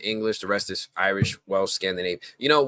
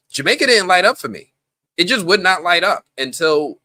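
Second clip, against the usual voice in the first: raised, loud exasperation; emphatic; 9 semitones higher, 7 dB louder than usual. Real, not acted. To the listeners about the DNA test, high loud exasperation.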